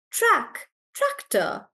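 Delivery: tractor with TRAC high, the rest dropping; stress on TRAC. Shown as a demonstration. Teacher modelling again for student, tractor stressed on TRAC. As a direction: punch TRAC first, then say tractor falling. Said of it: "like that, tractor".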